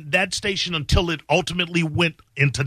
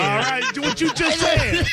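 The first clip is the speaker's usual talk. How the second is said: laughing; 10 semitones higher, slower than usual. Real, not acted. Laughing tease.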